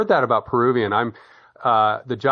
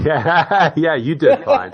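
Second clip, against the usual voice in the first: real laugh through the words; high, loud, laughing yeah; 6 semitones higher, 9 dB louder, slower than usual. Real, not acted. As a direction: reassure him while laughing. Laughing reassurance of the speaker.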